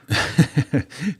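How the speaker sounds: bright high laugh; short high chuckle. Real, not acted. Laugh after advice.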